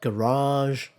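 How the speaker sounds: garage stressed on the second syllable; American stress. Shown as a demonstration. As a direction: say garage as "guh-RAHZH". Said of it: "So American".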